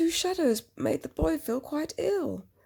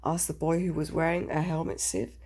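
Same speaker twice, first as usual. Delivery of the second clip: plain reading voice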